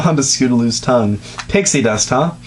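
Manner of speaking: normal talking voice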